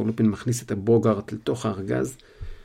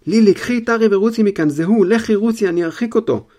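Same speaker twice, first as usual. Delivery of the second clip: high, loud panicked yell; father yelling to his wife to run; frightened shout; 9 semitones higher, 9 dB louder than usual. Acted. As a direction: shout in terror for her to flee. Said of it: "צועק, מבוהל".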